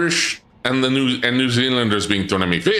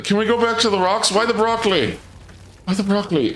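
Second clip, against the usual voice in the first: mock whining plea; 9 semitones higher than usual. Streamer begging chat for rocks instead of broccoli, mock whining. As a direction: whine it in pretend despair.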